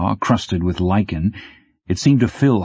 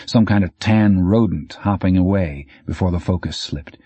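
plain reading voice

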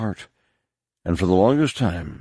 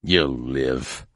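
lower, gruff growl; 5 semitones lower than usual. A narrator's reading. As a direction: dismiss him with a growl. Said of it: "growled the one who had cut him".

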